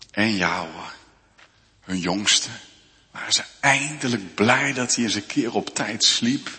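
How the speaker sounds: ordinary talk, nothing marked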